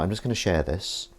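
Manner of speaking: ordinary talk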